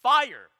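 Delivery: "Fire" shouted alone, high and loud, falling; emphatic stress. Shown as a demonstration. Preacher showing the Greek word order, punched emphasis. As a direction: hit "Fire" hard as the first word. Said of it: "this word fire is in what's called the emphatic position".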